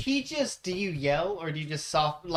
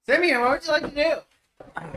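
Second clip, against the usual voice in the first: calling out; 9 semitones higher, 8 dB louder than usual. Real, not acted. GM calling player, louder.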